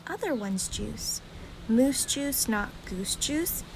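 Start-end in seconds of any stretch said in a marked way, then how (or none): none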